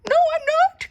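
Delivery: very high, loud cry. A narrator's reading. Eddie insists he is not the teddy, loud cry. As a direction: protest sharply. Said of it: "cried the voice".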